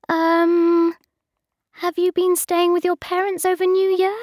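high, hesitant question; awkward question. A narrator's reading. Bridget nervously making small talk with Mark, high and hesitant. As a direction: ask shyly, unsure. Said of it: "I asked him".

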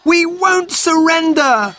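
high, drawn-out defiant shout; boy's high battle cry. A narrator's reading. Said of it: "cried Robert".

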